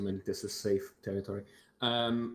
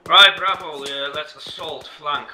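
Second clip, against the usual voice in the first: shouted order; 5 semitones higher, 10 dB louder than usual. Real, not acted. Squad leader orders assault.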